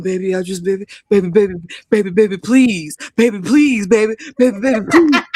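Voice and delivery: mock a man begging in bed; mocking Rick's begging. Man begging his partner in bed, mocked.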